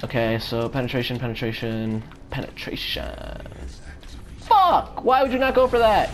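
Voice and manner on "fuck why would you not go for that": high, loud angry outburst; sudden furious yell; frustrated shout. Real, not acted. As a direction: blow up at the teammate. Teammate fails to engage.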